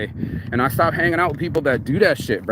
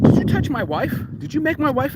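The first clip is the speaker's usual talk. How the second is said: mock mad; 11 semitones higher than usual. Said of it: "he just goes mad".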